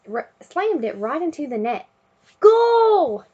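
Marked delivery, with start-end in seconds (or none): 2.4-3.2 goal shout. A narrator's reading. Team scores.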